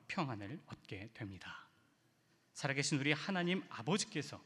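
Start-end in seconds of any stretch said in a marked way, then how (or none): none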